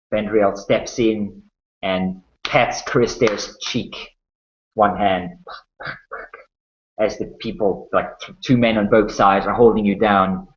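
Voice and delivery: ordinary talk, nothing marked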